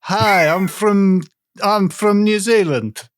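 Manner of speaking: put-on deliberately botched New Zealand accent; bad New Zealand accent. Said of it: "doing people's accents wrong".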